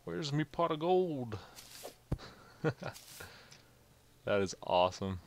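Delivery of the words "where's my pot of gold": high, playful Irish leprechaun. Pretend. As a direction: ham up a cheeky leprechaun.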